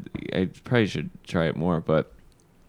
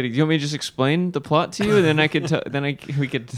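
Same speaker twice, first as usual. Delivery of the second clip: nervous eager offer; 7 semitones higher than usual. Retold or imitated. Nervous kid pitching plot.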